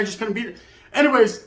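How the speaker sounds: ordinary delivery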